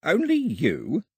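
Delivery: slow, high bewildered question. Acted. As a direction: ask in puzzled disbelief. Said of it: "looking bewildered".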